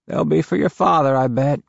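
a sighing grandmother; grandma voice. A narrator's reading.

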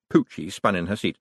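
ordinary delivery

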